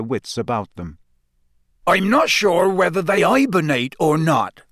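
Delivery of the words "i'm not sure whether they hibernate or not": higher, louder voice for the big gamekeeper; a lightly voiced Hagrid. A narrator's reading. Hagrid tells the shivering class, lightly voiced.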